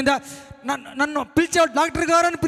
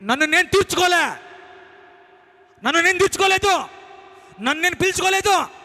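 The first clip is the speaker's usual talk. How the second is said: higher, louder declaration; fervent preaching; 6 semitones higher, 4 dB louder than usual. Acted. Preacher proclaiming to the congregation, in loud fervent preaching. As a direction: preach it at full force.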